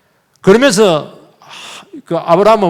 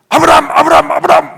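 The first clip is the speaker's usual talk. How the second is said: high, loud cry to halt someone; urgent calling out; 11 semitones higher, 6 dB louder than usual. Retold or imitated. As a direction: call the name out loud and fast, repeatedly. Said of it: "막 이러면서 막".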